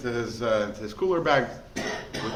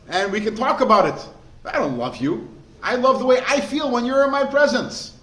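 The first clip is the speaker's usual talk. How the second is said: louder and higher, a fervent sermon push; preaching at full force; 5 semitones higher, 6 dB louder than usual. Acted. Rabbi preaching to his listener, loud and fervent. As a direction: drive the point home to the crowd.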